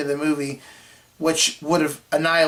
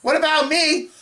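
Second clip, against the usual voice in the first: indignant retort; 9 semitones higher than usual. Retold or imitated. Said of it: "And I'm like".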